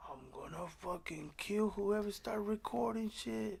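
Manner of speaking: slow put-on dragon threat; lightly voiced dragon threat; mock dragon voice. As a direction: growl it like an annoyed dragon.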